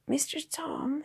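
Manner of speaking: put-on high, small croaking child's voice; weak croak. A narrator's reading. Waking boy calling to his guardian, croaking. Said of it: "he croaked".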